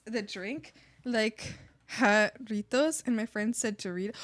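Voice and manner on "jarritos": Jarritos said slowly with a Spanish rolled r; Spanish pronunciation. Shown as a demonstration. Explaining the drink name to friends, slow Spanish Jarritos.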